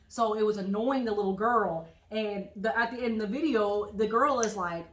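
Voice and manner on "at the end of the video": ordinary talk, nothing marked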